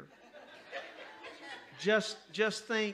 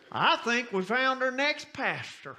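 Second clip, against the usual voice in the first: higher, louder announcing voice; loud, high country churchman making a proposal; church member; 6 semitones higher, 4 dB louder than usual. Acted. Church member to young preacher after the sermon, loud announcing voice. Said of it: "a man walks up and says".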